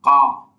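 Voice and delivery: কা said as a clear Arabic qaf from the back of the tongue; Arabic letter demo. Shown as a demonstration. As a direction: sound out কা as a careful Arabic model. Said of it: "আমরা দেখি কিভাবে উচ্চারিত হচ্ছে".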